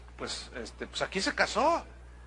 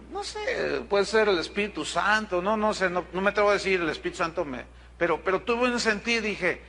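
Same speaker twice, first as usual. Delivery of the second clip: ordinary delivery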